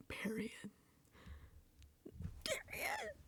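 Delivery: hushed, breathy-free whisper of period. Retold or imitated. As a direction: whisper it like a secret verdict. Whispered reaction to a win.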